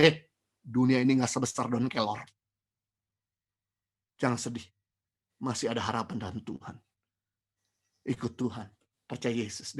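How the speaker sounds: put-on comforting a heartbroken girl, soft and low; gentle comfort. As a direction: softly reassure someone who is crying. Comforting weeping sister-in-law.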